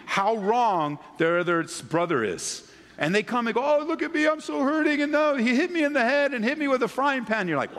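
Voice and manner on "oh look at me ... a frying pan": put-on high, whiny child voice; tattling kid. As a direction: play the wounded kid telling on his brother.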